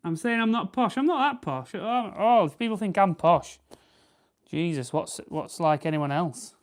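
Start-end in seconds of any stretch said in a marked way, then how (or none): 0.0-3.6 high-pitched, laughing denial of being posh; high, amused protest to a viewer who calls her posh; indignant protest. Real, not acted.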